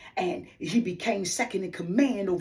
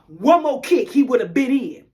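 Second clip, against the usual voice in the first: ordinary talk, nothing marked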